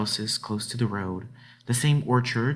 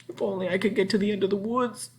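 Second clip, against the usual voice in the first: higher, fast and breathless; gasped; 10 semitones higher, faster than usual. Acted. Frightened girl racing through the woods, gasping. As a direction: gasp it out mid-run in fear. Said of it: "she gasped".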